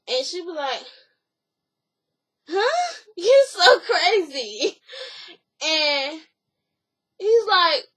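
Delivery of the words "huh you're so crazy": a very high "Huh", then slow words through a laugh; laughing disbelief. Retold or imitated. Acted reaction to the proposal.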